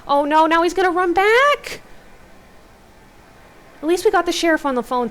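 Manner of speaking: high, loud worried reaction; alarmed outburst. Real, not acted. Reader reacting to the story's bad turn, alarmed outburst. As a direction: blurt it out high and loud, dismayed.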